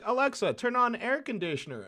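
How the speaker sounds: loud clear command. Retold or imitated. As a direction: call the order up to the device.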